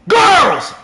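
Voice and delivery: high, loud one-word blast; triumphant shout. Real, not acted. Streamer answering his own question with a triumphant shout. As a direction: punch out the answer.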